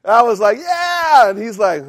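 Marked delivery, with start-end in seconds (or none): 0.6-1.3 high, loud cheer; celebrating. Retold or imitated. Young believer celebrating to his group leader, elated cheer. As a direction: reenact an elated "yeah", pitched way up. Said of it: "damper on my celebration".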